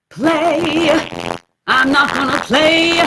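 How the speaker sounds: high, loud "play" with a "ya" tail; shadow vowel. Shown as a demonstration. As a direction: punch "play" with an extra "ya" after it. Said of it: "add a shadow vowel".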